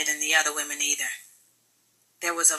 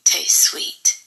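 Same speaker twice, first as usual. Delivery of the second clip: slow breathy whisper; whispered epigraph; 3 dB louder, slower than usual. Acted. Narrator opening the novel in a slow whisper. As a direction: hush it like a secret quotation.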